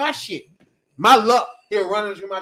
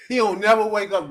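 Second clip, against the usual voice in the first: ordinary talk, nothing marked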